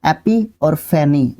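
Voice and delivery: एपी और फेनी, slowly in two parts; slow teaching split; two-syllable split. Shown as a demonstration. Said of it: "दो syllable में आप अगर पढ़ के चलोगे".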